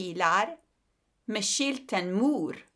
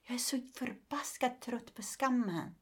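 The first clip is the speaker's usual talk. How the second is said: real weary whisper; 4 semitones higher, 6 dB quieter than usual. Real, not acted. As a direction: whisper it, worn out. Speaking of shame. (trött viskning)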